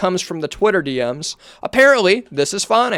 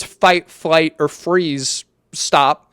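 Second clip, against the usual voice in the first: slow, spaced-out emphasis; much slower than usual. Real, not acted. Stressing each survival response.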